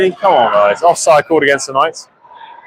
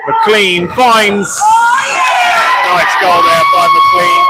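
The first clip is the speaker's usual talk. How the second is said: louder excited goal call; 9 semitones higher, 4 dB louder, much slower than usual. Acted. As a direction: celebrate the goal as you call it. Goal scored live.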